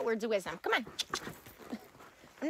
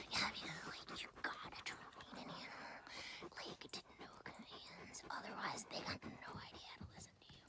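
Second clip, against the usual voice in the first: soft breathy whisper as the dog; dog's inner voice; 5 semitones lower, 19 dB quieter than usual. Acted. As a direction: whisper the dog's wise words. Voicing the dog.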